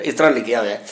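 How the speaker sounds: ordinary delivery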